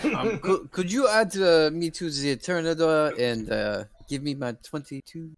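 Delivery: put-on French-Russian accent. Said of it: "give that guy a medal for his accent".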